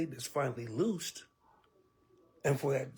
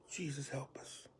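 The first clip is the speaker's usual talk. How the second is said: much softer, breathy plea to Jesus; hushed prayer; 10 dB quieter than usual. Real, not acted. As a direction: pray under your breath. Prayer aside in the sermon.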